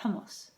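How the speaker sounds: hummus softly as HOM-moos, airy h; correct pronunciation. Shown as a demonstration. Cook settling on the proper word, soft and correct. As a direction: say hummus gently with rounded vowels.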